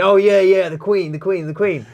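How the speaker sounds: imitated eager self-correction, starting high and loud; flustered agreement. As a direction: blurt agreement, then tail off repeating. Imitates confused man hastily correcting himself.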